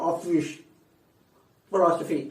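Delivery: ఆఫీస్ with F from lip and teeth; ఫిలాసఫీ with labiodental F sounds; native English pronunciation. Shown as a demonstration. Teacher contrasts English speakers with Telugu habit, native pronunciation. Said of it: "వాళ్ళు దాన్ని పలకాలంటే".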